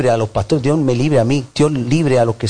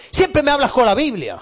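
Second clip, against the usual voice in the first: higher whiny complaint; mock complaining member; 9 semitones higher than usual. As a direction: mimic the member's grumble, higher. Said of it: "lo que nos dicen muchas veces".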